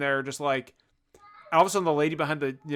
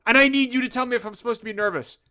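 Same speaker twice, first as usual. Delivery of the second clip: high, raised, anxious insistence; acted panicky demand; frantic pleading; 7 semitones higher, 4 dB louder than usual. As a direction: plead urgently for reassurance. Pleading with store owner in panic.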